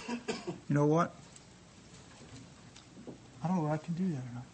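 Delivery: ordinary delivery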